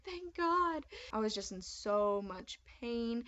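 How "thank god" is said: high, thankful exclaim; thanking God the doctor came; relieved. Retold or imitated. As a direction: breathe out big relief. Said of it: "I was like".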